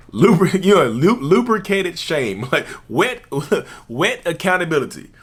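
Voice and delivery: laughing through the words; cracking up mid-sentence. Real, not acted. Laughs at own phrase.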